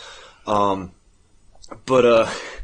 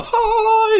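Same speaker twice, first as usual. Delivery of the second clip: sudden squeaky "Ha" yelp; 突然尖声一叫; high mock yelp; 3 dB louder than usual. Melkor mock sound.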